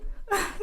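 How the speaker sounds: a short breathy laugh through tears; nervous laugh. Real, not acted. Apologising to the listener for getting emotional, a small breathy laugh. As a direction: laugh it off, embarrassed at welling up. Tearful laugh mid-story.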